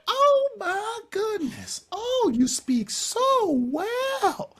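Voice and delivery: high, slow, gushing Southern belle voice; Southern accent. Retold or imitated. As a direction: gush it out like an amazed Southern lady. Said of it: "she had a Southern accent".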